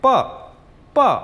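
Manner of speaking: पा with a sharp jolt, falling fast; पा, a quick abrupt falling pa; sharp falling fourth tone. Shown as a demonstration. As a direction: snap पा down hard like a Chinese fourth tone. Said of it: "झटका दे के बोलेंगे".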